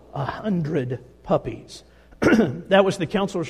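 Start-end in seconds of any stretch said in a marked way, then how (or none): 0.1-1.8 a hundred puppies, said slowly with a falling tune; slow, stunned repeat. Acted. Preacher repeats the number to the congregation, slow and stunned. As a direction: let the number land, each word drawn out.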